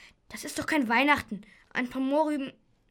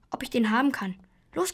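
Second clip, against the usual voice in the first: ordinary delivery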